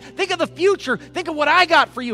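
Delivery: high, loud, urgent exhortation; full-force preaching. Acted. Preacher voicing Paul's charge to keep running, impassioned. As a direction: drive every command home to the congregation.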